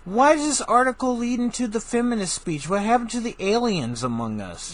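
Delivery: high, loud indignant questions; loud, high-pitched exasperation; exasperated outrage. Real, not acted. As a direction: demand an answer in comic disbelief. Comic outrage at article's turn.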